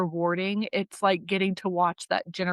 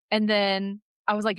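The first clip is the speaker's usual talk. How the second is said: usual speaking voice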